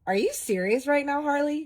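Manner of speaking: high, loud, incredulous snap at him; exasperated outburst. Retold or imitated. Exasperated at boyfriend pushing her boundaries, loud incredulous outburst. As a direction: call him out, shocked and fed up.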